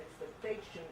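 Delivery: normal talking voice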